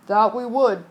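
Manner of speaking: lightly gruff calm captain. Acted. Captain agreeing with the passenger. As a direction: agree like an old sea dog. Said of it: "said the captain".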